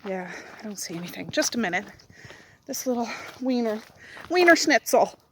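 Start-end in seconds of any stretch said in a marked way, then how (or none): 2.7-5.2 high, loud playful nickname; silly pet voice. Real, not acted. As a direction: tease the little dog.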